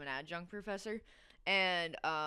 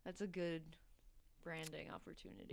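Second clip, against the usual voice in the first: whisper; 8 dB quieter than usual. Real, not acted. Joking aside.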